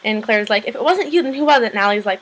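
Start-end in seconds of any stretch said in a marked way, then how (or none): 0.7-1.7 high, indignant retold demand; mildly heated, raised-pitch challenge; mock confrontation. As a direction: imitate a girl demanding an answer. Retold confrontation between the girls.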